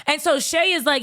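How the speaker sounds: ordinary talk, nothing marked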